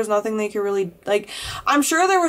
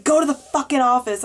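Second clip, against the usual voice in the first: retold angry order; 5 semitones higher than usual. Angry teacher orders student out.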